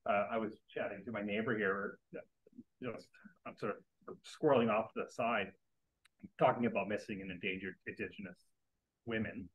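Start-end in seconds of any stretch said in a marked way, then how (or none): none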